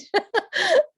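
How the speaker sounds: squeaky high laugh through tears; high emotional laugh. Real, not acted. Remembering her daughter's words, a high teary laugh. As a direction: laugh it out, pitch shooting up. Laughing after crying story.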